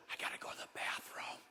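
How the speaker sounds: put-on urgent child's whisper; hushed request. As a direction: whisper it privately to the teacher. Child privately asks teacher for bathroom.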